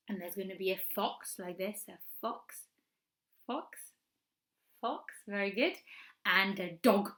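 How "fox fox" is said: ordinary talk, nothing marked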